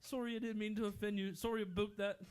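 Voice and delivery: imitating a Canadian apology; mock apologetic Canadian. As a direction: play the sorry Canadian. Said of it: "it's like".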